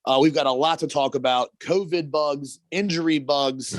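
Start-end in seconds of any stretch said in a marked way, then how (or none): 1.6-3.8 slow topic list. Acted. Listing topics for show.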